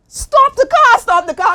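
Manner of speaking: yelling high and loud to stop the car; frantic shout of stop the car; panicked shout. Retold or imitated. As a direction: scream it out, high and urgent. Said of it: "I'm telling her".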